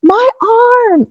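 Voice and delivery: retold cry of pain during the incision; high pained cry. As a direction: cry out where it hurts. Crying out in pain on table.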